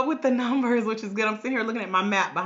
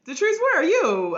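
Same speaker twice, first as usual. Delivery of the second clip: calling out; 8 semitones higher, 6 dB louder than usual. Real, not acted. Calling out to a viewer.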